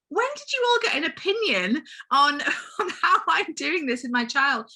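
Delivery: mock outrage at the relatives' opinions; indignant retort. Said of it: "I'm like".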